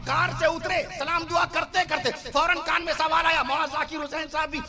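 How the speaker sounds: high, forceful sermon delivery; fiery preaching. Real, not acted. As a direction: drive each phrase home to the crowd. Preacher telling story at full force. (پرجوش خطیبانہ انداز، اونچی آواز)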